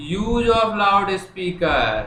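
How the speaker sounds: very slow, word by word; slow dictation. Shown as a demonstration. Teacher writing the letter on the board, slow dictation. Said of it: "अब मैं लिखना शुरू कर रहा हूँ".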